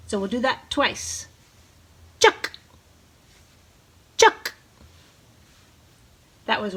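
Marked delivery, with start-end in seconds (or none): 2.2-4.5 short loud "chuck" chirps; clipped bird-call imitation; thrasher call. Shown as a demonstration. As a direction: bark it like a thrasher. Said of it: "The sound he makes is chuck".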